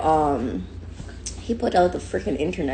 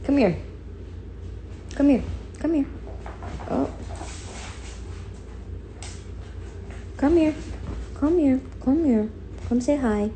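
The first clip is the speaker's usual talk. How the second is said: calling puppy; 7 semitones higher, 4 dB quieter than usual. Real, not acted.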